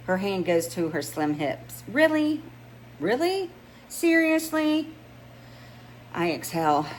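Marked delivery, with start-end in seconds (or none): 1.9-4.9 much higher, rising incredulous squeaks; can you believe this woman; exasperated disbelief. Acted. Rennie exasperated with her friend.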